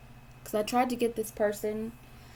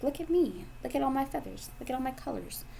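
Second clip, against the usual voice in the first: showing-off peacock; 5 semitones higher, 3 dB quieter than usual. Retold or imitated. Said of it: "you're like a peacock".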